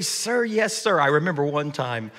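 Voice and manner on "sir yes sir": put-on kids saluting. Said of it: "And they'd say".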